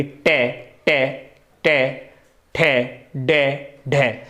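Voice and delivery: टै ठै डै ढै stretched out slowly, tongue curled back; teacher modelling retroflex stops; slow retroflex demo. Shown as a demonstration. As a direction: sound out टै, ठै, डै, ढै each one slow and separate. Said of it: "तालु से आगे थोड़ी सी जीभ सटे".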